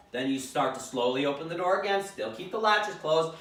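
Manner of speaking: ordinary talk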